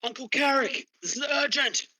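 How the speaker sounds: high, loud urgent call through the door; urgent knock-call. Acted. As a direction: bang on the door and call out in a panic. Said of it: "like, an urgent knock".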